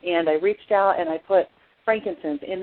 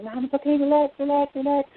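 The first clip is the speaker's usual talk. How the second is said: reassuring; 8 semitones higher than usual. Retold or imitated. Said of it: "I'm like".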